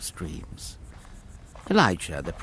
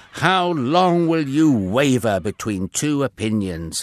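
louder, raised call to a crowd; 5 semitones higher, 8 dB louder than usual. A narrator's reading. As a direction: project it to the whole mountain. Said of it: "he called out".

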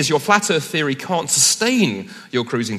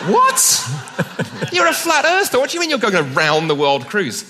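high, loud, laughing disbelief; mock outrage at a friend's contradiction; incredulous disbelief; 9 semitones higher, 5 dB louder, slower than usual. Asking the flat-earther friend about his world cruise, incredulous laugh. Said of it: "And we're like".

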